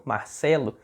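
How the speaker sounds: "Marcelo" with a soft Bahian R; the rejected Bahian "Marcelo", R as an h; Bahian accent. Acted. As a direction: Bahian accent, R barely sounded. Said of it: "amigos meus de lá da Bahia falam".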